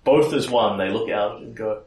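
normal talking voice

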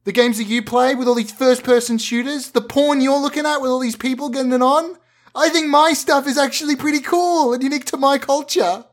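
put-on indignant teenage son. Teen son counterattacks dad.

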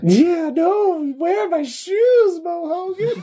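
high silly character voice. Acted.

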